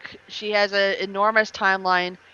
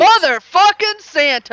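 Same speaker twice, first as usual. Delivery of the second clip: loud, slow, higher meme yell; wild unhinged ranting; 7 semitones higher, 6 dB louder, slower than usual. Pretend. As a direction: bellow it like a cartoon villain. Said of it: "There we go".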